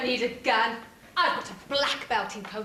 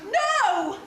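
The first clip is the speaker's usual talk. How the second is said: shocked cry; 10 semitones higher, 4 dB louder than usual. Acted. Hero shocked at news.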